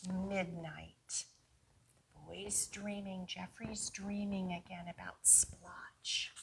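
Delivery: soft night-time whisper. A narrator's reading. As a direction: hush the room for midnight. Night scene, hushed narration.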